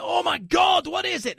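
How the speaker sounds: high, hammed-up thrilled cry; acted amazement. Customer opening the box at the door, acted amazement.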